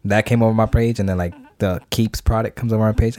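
ordinary delivery

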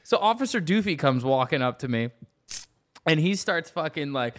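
ordinary talk

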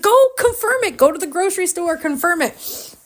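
high-pitched, excited commands; imitated urging. Co-host sending winner to the store, high excited urging. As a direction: urge it on high and hurried. Co-host's retold urgent order.

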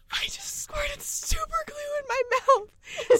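high, slow, strained squeak of panic; mock panicked squeal. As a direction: imitate her frantic little cry. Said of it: "Why are you screaming?".

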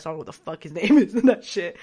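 laughing, quick and loud; amused rush through the line. Real, not acted. Laughing over forgotten name.

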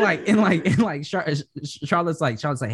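normal talking voice

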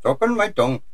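put-on Indian accent, softly echoing a joke; quiet mock Indian accent. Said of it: "all my Indian accent".

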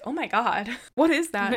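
retold alarm with a laugh breaking in; imitated startled friend. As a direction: blurt it as an appalled friend, half laughing. Acts out friend's startled reaction.

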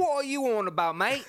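high, loud cartoon British; British accent. Host mimics an indignant Brit to tease co-host, high British squawk. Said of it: "you sound exactly like that".

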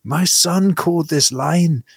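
high, slow, angry Glaswegian mum; hammed-up Glaswegian scold; irate Glaswegian mother. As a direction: Glaswegian mother complaining slowly. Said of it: "an irate Glaswegian mother being like".

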